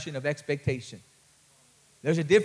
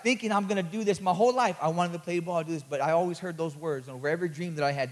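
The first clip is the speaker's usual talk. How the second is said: usual speaking voice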